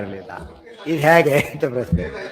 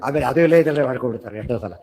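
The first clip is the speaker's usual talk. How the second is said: ordinary talk, nothing marked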